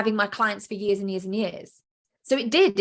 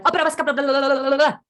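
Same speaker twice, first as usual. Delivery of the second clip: high, loud frantic gabble; the unconscious mind babbling in a panic; panicky babble; 5 semitones higher, 5 dB louder than usual. Pretend. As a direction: babble on in a flustered rush, pitched up. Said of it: "the unconscious is going".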